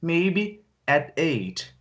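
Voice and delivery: very slow, stretched reply; slow repeated answer; drawn-out answer. Acted. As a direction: stretch it out again slowly. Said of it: "Eu não falei".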